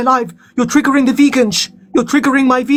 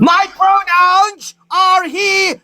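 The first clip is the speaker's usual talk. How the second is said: shrill-high shouted insistence, slowly; high insistent shout; 9 semitones higher, much slower than usual. Real, not acted. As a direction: yell it high and slow in protest. Insisting on pronouns to mocker.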